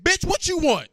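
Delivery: loud, high angry mom picking up the phone; mom answering the phone. Retold or imitated. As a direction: snap at the caller like an irritated mother. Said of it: "I said".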